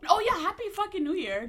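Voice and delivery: high, loud sarcastic cheer. Real, not acted. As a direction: spit out a fake cheer. Sarcastic new year greeting.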